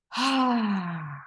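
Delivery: long audible breath-out sigh; exhale sigh. Shown as a demonstration. Coach breathing out with the viewers, a long audible sigh. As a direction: let all the air go in one big sigh. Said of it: "and then you're gonna let it all out".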